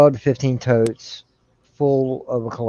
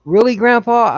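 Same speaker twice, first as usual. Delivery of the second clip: put-on high, louder questioning kid voice; granddaughter imitation; 11 semitones higher, 5 dB louder than usual. Ten-year-old granddaughter questioning her grandpa's rule, imitated child voice. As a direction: imitate a child, pitched up and disbelieving. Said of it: "She goes".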